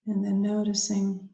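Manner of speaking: guided meditation. Acted. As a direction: ease them gently inward, unhurried, leaving space after each phrase.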